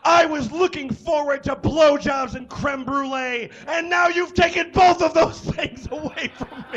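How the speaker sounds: high, loud outraged yell, laughing as it goes. Acted. Gordon yelling at Paula about his lost dessert, high laughing yell. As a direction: bellow the complaint, cracking up. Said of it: "continued to yell".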